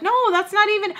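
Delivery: high protesting yelp; indignant. Retold or imitated.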